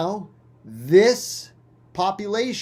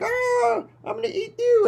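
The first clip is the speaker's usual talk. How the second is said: high, squawky predator voice; hammed-up bird threatening its prey; cartoon bird cry then threat; hungry bird.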